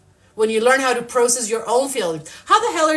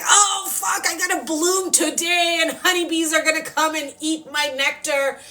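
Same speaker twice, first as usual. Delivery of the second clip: cussing sunflower; 4 semitones higher than usual. Retold or imitated. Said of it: "cussing, screaming".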